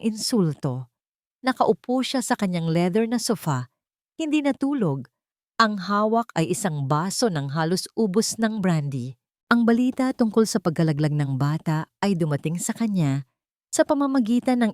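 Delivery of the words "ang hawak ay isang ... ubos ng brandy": usual speaking voice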